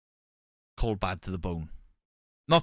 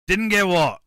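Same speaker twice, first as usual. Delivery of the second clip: mock shout; 8 semitones higher, 9 dB louder than usual. Louder mocking repeat.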